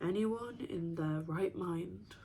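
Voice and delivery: soft, drawn-out echo; slow, hushed repeat; musing echo. Acted. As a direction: say it slowly and softly, turning the phrase over. Repeats mother's phrase, pondering it.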